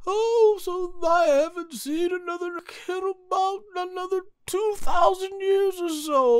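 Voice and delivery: put-on very high, creepy old-man falsetto; thin falsetto old-timer voice; Neva impression. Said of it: "he did a great job sounding".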